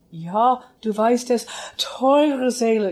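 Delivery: copying a famous singer's phrasing. Retold or imitated. Student mimics her teacher's recording, dramatic German imitation. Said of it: "a complete imitation".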